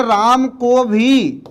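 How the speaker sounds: राम को भी drawn out very slowly; very slow emphatic भी; stress on भी. Shown as a demonstration. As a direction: stretch भी to stress Ram too. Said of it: "विशेष जोर देकर बोल रहे हैं".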